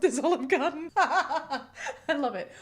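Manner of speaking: breaking into laughter. Real, not acted. Amused host reading a buffet meme to viewers, laughing. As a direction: read it out, cracking up. Reads joke, cracks up laughing.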